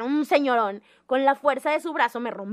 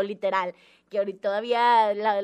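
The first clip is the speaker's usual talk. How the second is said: usual speaking voice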